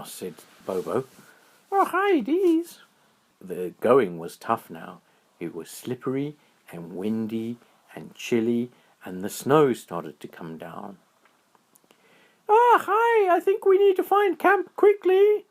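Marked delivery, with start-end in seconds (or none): 1.7-2.8 very high, excited little squirrel voice; high squeaky critter voice. A narrator's reading. Squirrel voice held. 12.5-15.5 very high, excited little squirrel voice; high squeaky critter voice. A narrator's reading. Squirrel voice held.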